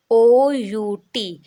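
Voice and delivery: letters O U T spelled slowly and loud; slow spelling. Shown as a demonstration. Teacher spelling for beginners, slow and loud. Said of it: "आपको ओ U T दिखाई दे".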